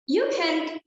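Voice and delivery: usual speaking voice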